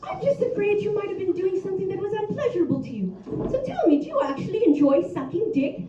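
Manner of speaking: high, prim, proper gentleman voice; posh gentleman. Retold or imitated. She imitates her polite lover in a prim, high gentleman voice. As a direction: play him as a genteel old-fashioned gent. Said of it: "quoting him".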